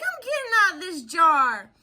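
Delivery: high, determined little cow voice; defiant baby cow. Pretend. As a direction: declare it like a tiny cow breaking free. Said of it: "And you're like".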